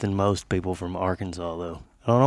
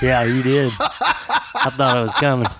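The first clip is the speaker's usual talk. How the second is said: laughing through the words; real laugh, teasing his friend; 4 dB louder than usual. Real, not acted. Co-host laughing at the near slip of quickie, real laughter.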